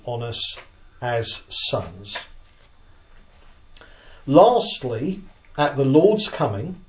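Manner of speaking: slow, measured reading with pauses between phrases; deliberate teacherly read-aloud of a written summary. Real, not acted. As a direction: read it out slowly, phrase by phrase, so listeners can follow. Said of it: "I'm just gonna read them slowly".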